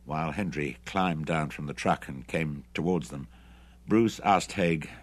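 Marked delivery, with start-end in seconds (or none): none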